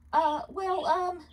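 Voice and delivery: high, halting stammer. Acted. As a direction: caught out, fumbling. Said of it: "began to stammer".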